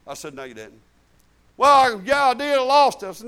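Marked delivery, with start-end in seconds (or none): 0.3-0.8 much softer and lower, a firm "No, you didn't"; quiet insistence. Retold or imitated. Quiet repeat of the rebuke. 1.6-3.1 louder, higher protest of a believer who lost it all. Retold or imitated. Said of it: "retold believer protests".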